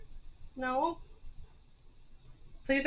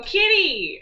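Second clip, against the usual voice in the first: high, louder call to the kitty; excited call; 7 semitones higher, 5 dB louder than usual. Real, not acted. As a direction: call the cat out brightly. Calling out to the cat.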